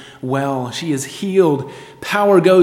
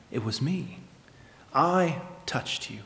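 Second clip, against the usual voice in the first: soft, hesitant admission; hushed and halting, as the timid woman; timid confession; 10 dB quieter than usual. Acted. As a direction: own up softly, with a long pause between phrases. Timid healed woman confesses to Jesus.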